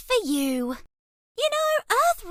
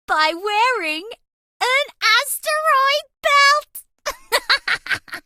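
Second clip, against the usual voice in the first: punchline delivery; 6 semitones higher, 4 dB louder, much slower than usual. Acted. Punchline of a joke.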